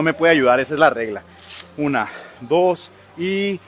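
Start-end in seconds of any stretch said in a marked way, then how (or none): 1.8-3.6 slow count. Real, not acted. Counting reps under heavy load.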